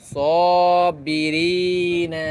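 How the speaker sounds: each word drawn out slowly with long madd; elongated vowels. Shown as a demonstration. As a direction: read each word stretching the vowel one alif. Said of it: "এক আলিফ লম্বা করে পড়তেছি". (মদ টেনে ধীরে পড়া)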